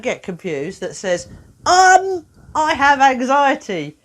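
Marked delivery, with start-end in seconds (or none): 1.7-4.0 higher, louder little voice saying I have anxiety; timid child's label read, higher and louder; mock child's label voice. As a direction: play the labelled kid, up and loud.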